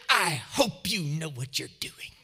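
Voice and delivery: pointed, mock-ominous warning in another's voice; retold warning. Angry director warns her pastor, retold pointed disapproval. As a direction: imitate a disapproving woman's warning. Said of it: "came in my office so mad".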